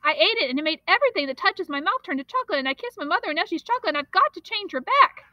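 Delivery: fast, high panicked babbling; frantic boy babbling to the storekeeper. Acted. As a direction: pour it all out in a rush. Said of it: "he babbled on".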